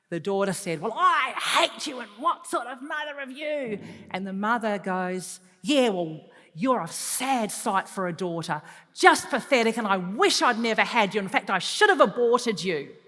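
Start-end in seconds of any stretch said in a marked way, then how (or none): 0.8-3.8 mock daughter's furious outburst; angry meltdown. 5.6-13.0 loud, cutting comeback from a mother; mock furious mother; angry retort. Mother retaliates in acted quarrel.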